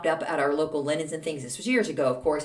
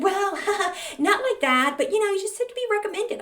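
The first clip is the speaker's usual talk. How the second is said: pitched way up, nervous giggle, fast; high prissy voice; 13 semitones higher than usual. Retold or imitated. Neighbor dodging the question, high and fast. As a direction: squeak it out with an awkward laugh. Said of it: "she's like".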